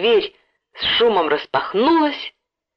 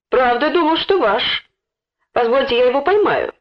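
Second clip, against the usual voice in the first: higher, eager-to-help voice; obliging; 5 semitones higher than usual. A narrator's reading. Boy offers to catch the thief, obliging. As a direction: offer helpfully, pitch lifted. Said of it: "услужливо предложил он".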